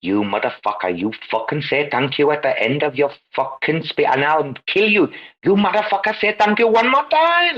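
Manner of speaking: imitated Indian tirade, rising steeply in pitch; Kumar. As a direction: build to a high, outraged mock threat. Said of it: "the man's like".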